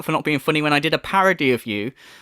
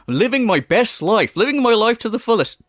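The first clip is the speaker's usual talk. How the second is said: mock quote of streamer; 5 semitones higher, 3 dB louder than usual. Commentator mocking the streamer's clip, smug higher mimicry. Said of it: "he said in this clip".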